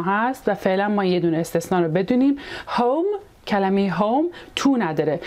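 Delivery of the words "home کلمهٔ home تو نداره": normal talking voice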